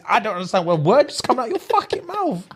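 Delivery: high-pitched mock outrage; indignant comeback. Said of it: "So a normal person would be like".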